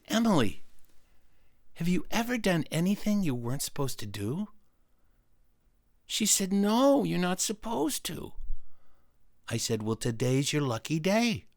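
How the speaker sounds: quiet, measured temptation; conspiratorial coaxing. Acted. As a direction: lean in softly to persuade her. Coaxes her to break rules.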